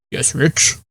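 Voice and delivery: soft, lightly voiced grandma; hushed hint of an old grandmother; grandma's reply. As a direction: answer gently as grandma. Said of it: "she'd be like".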